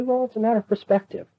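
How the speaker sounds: glib dismissal. Retold or imitated. Mocking those who shrug off evil, glib and quick. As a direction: toss it off like a shrug.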